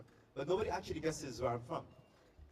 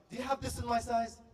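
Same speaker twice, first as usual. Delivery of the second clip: higher, pushier customer demand; impatient repeat; 7 semitones higher than usual. Retold or imitated. Customer repeats the question to the shopper, irritated. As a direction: press her again, annoyed. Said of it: "She said".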